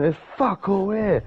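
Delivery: high-pitched, aggressive dismissal; retold aggressive outburst. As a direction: act out getting aggressive to warn him off. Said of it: "I started getting really aggressive".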